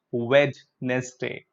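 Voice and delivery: "Wednesday" slow, wed-nes-day with D; wrong Wednesday. Shown as a demonstration. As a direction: sound every letter of "Wednesday". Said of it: "It's not".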